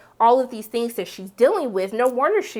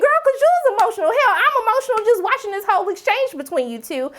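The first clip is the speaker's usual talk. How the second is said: much higher, fast and excited; emotional reply; 12 semitones higher, 4 dB louder than usual. Retold or imitated. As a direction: gush back at her, all worked up. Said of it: "I was like".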